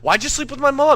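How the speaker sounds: high, loud indignant yell; outraged. Acted. Confronting Jimmy over his mom, high outraged yell. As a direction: demand an answer in outrage.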